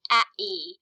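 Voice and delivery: "a-e" drawn slowly, happy without consonants; vowels only. Shown as a demonstration. Teacher showing happy's two syllables, vowels only. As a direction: sound out "a-e" with mouth kept open. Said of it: "I didn't close my mouth".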